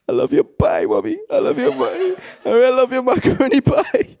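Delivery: very high, laughing backpedal; mock frantic appeasement. Son backtracking to his angry mother, mock pleading. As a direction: plead sweetly to cover a blunder, hammed up.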